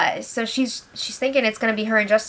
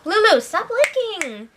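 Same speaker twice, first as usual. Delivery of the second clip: high sing-song scold to a pet, much higher; talking to the dog; 12 semitones higher than usual. Real, not acted. Scolding her dog off-mic.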